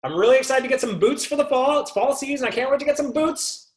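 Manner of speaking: high, bubbly eager shopper voice; excited customer. Retold or imitated. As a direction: gush about the boots like a thrilled shopper. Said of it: "You walk into the store".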